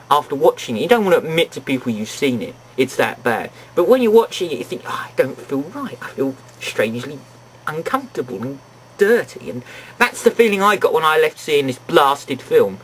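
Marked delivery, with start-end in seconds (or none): 4.9-9.5 lower, subdued queasy confession; mock-uneasy, dropped voice; uneasy viewer. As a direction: voice an uncomfortable private thought, softly.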